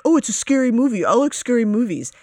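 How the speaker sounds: high, loud, quick imitation of a naive fan; mock horror fan. Mimics casual horror fans dismissively.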